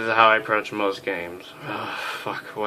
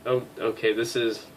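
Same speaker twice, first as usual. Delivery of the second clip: ordinary talk, nothing marked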